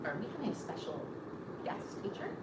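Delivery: hushed aside. Retold or imitated. Newcomer quietly wondering about a special guest, soft hushed aside. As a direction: lean in and ask under your breath. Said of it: "And I'm like".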